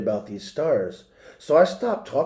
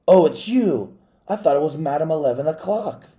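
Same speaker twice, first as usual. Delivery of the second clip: excited; 4 semitones higher, 6 dB louder than usual. Acted. She jumped up and said.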